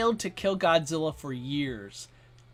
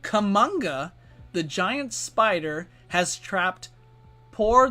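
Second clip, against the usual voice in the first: normal talking voice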